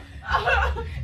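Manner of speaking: high burst of laughter; loud laugh. Real, not acted. Laughing at her partner's joke, loud laugh.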